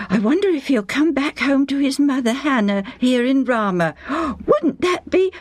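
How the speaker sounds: high, eager voice with gasps; wife excited that Samuel might come home. Acted. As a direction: gasp as the hope dawns.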